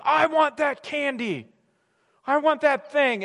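put-on drawn-out childish wanting; whiny toddler. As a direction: plead like a kid in the candy aisle. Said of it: "toddler who's like".

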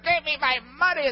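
high, harsh demand while choking him; angry demand. Acted. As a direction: grab him and demand the money. Servant choking his debtor.

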